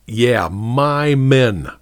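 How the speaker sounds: slow, weighty "my"; defiant stress on my. A narrator's reading. Sergeant defiantly claiming his men, slow. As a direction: lean hard on "my".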